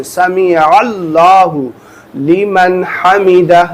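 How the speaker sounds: سمع اللہ لمن حمدہ very slow and careful; teacher showing the right pace; slow and clear. Shown as a demonstration. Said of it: "اس طریقے سے پڑھنا ہے".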